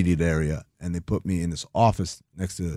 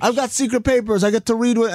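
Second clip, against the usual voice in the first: much higher, mock-thrilled squeal; giddy excitement. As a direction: gush like a kid with a secret. Mock excitement over secret papers.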